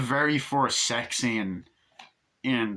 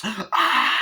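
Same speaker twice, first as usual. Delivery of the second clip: high, loud mock scream; imitated scream; 10 semitones higher, 6 dB louder than usual. Maid turning round to the gory sight, loud mock scream. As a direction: scream as if finding bodies.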